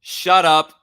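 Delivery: loud playful shout; loud shut up. Real, not acted. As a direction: bark it loud and sharp. Loud retort cutting off co-host.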